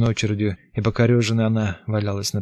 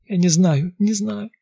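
high, strained helpless cry; helpless pleading; 10 semitones higher, 4 dB louder, slower than usual. Acted. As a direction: repeat it in helpless panic. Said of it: "беспомощно хрипела я".

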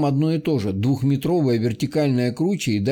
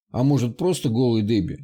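ordinary delivery

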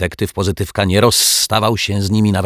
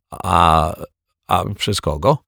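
cautious; slower than usual. Acted. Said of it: "zapytał ostrożnie martwiak".

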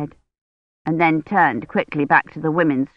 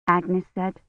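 usual speaking voice

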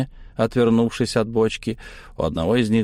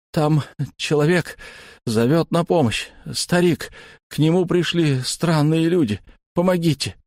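put-on higher strained boy's plea; frozen boy pleading; 7 semitones higher than usual. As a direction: force the words out, pleading. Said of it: "Вытолкнул я из себя". (мальчик с трудом выдавливает мольбу)

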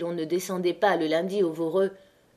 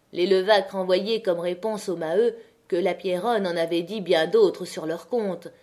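usual speaking voice

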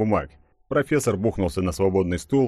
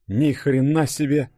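higher, slow-drawn astonishment; impressed surprise; 7 semitones higher, slower than usual. A narrator's reading. Denis reacting to news of civil war, impressed surprise. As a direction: stretch it out in amazement. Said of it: "присвистнул Денис".

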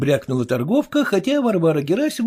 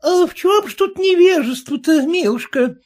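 higher, chatty peddler voice; meddling peddler woman; 13 semitones higher, 3 dB louder than usual. A narrator's reading. Peddler woman butting into the quarrel, chatty. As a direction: butt in, folksy and familiar. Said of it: "вмешалась в разговор торговка".

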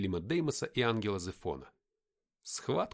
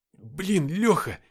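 high, loud outburst to a friend; agitated chatter; 7 semitones higher, 10 dB louder, much slower than usual. A narrator's reading. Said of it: "затараторил он".

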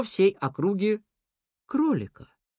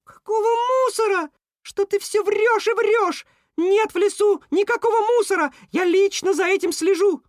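very high, emphatic outraged voice; squealing rat; 5 dB louder than usual. A narrator's reading. Rat denying any rubbish in his forest, squealing. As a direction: shriek the denial indignantly. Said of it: "взвизгнул вдруг Крыс".

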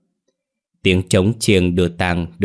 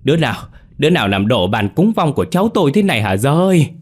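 fast, high, loud lament; anguished wail; 6 semitones higher, 5 dB louder, faster than usual. A narrator's reading. Grandmother laments the wrecked altar, fast high wail. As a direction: cry out in grief and shock. Wailing over toppled altar.

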